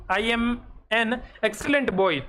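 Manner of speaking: put-on "an excellent boy" drawn out slowly, word by word; slow corrected example. Shown as a demonstration. Teacher to students, slowly dictating the correct sentence. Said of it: "अगर इसी को मैं यूँ लिख देता कि".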